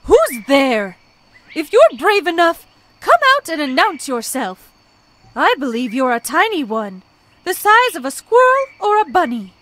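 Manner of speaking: loud, high demand to show yourself; firm loud challenge. A narrator's reading. Ostrich loudly challenging the hidden bunny, firm. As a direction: call out boldly, daring them. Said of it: "more firmly".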